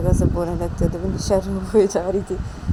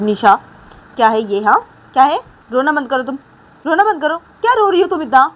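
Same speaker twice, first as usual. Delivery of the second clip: exasperated scolding, rising higher and louder; scolding mother; 4 semitones higher, 5 dB louder than usual. Acted. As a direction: scold a crying child to stop. Mother scolding crying Nisha. (डाँटती हुई माँ, झुंझलाई आवाज़)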